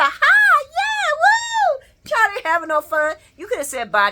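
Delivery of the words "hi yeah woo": high, loud fake-cheerful "hi, yeah, woo"; mock cheer. Mocking Donna's fake fun, high squealing cheer. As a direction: ham up a squeaky party cheer.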